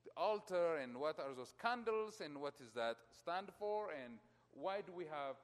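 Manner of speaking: ordinary delivery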